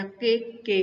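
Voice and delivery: "के के" as two separate, equal syllables; wrong pronunciation. Shown as a demonstration. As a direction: say "के के", repeating the syllable with a break. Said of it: "ऐसे नहीं बोलेंगे".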